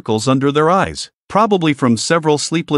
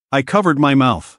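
usual speaking voice

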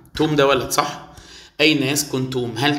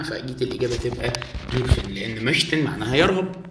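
normal talking voice

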